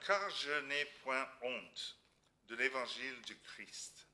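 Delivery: slow, solemn Bible reading; scripture reading. Acted. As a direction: read the verse slowly and gravely. Reads a Bible verse aloud.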